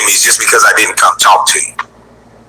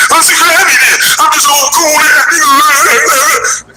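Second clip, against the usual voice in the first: high-pitched, slow mock whine; mocking upset man; slower than usual. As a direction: mimic him upset in a silly high voice. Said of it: "you was upset".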